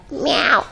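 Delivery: high, falling cat cry; imitated cat meow. Cat waking its owner to be fed, high meow. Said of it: "like this".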